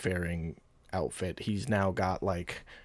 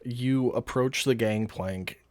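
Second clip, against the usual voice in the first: usual speaking voice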